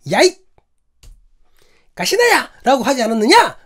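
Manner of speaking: high, loud mock scolding; hammed-up barked insult, pitched high; accusing quote. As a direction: re-enact a harsh yell as an accusation. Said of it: "당신의 억양을 보고 표정을 보니까 이건 완전 쌍욕이다".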